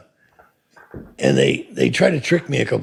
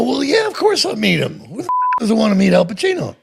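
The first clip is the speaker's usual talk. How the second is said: eager; 9 semitones higher than usual. Retold or imitated. Eager reply in retold story.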